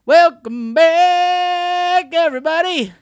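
high, loud and very slow hype; overexcited. Acted. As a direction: shout it like a hyped host. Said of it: "He's practically jumping up and down".

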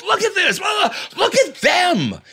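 high, loud shrill tirade at the husband; mocking a wife shouting at her husband; berating shout. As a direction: scold him in front of the whole room. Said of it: "And I mean berating".

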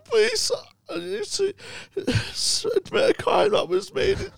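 very high, crying wail to the police; tearful Mike. Retold or imitated. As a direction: sob it out high and broken. Tears in his eyes.

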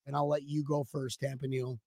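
ordinary delivery

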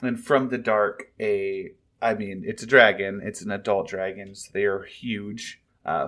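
ordinary talk

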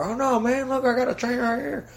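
put-on high, fast, pushy good old boy; pushy country guy. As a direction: push your help on him like a pushy local. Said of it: "pushy about it".

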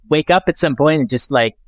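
usual speaking voice

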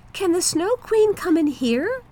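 put-on high, curious little girl. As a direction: ask wide-eyed like a small girl. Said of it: "asked the little girl".

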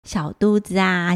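plain reading voice

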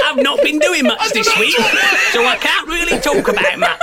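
high, loud laughing voice. Pretend. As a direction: squeeze the words through laughter. Mocking voice while laughing.

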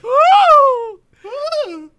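loud, high "woo"; high whoop. Real, not acted. Host after doing a grandma voice, loud whoop. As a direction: let out a big high whoop.

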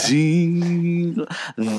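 slow, drawn-out villain intro; Regina George delivery. Retold or imitated. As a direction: lightly mimic a diva's slow self-introduction. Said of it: "the way she says".

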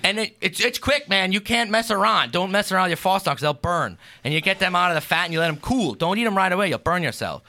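homey, lightly put-on Pennsylvania Dutch accent. Explaining how to fry Fastnachts, mild Pennsylvania Dutch accent. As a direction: tell the recipe like a Pennsylvania Dutch farmer. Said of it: "just a heavy accent".

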